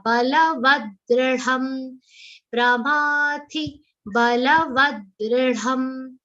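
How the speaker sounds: slow Balavadridham with the ri sound; Sanskrit recitation. Shown as a demonstration. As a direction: chant pramathi balavadridham slowly. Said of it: "it has a Rishi's Ru matra".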